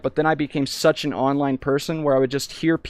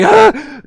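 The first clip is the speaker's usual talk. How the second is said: sudden high yelp of panic; a loud, high "Ah!" of being overwhelmed; retold scream; 9 dB louder than usual. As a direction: let out a short overwhelmed shriek. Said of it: "I was like".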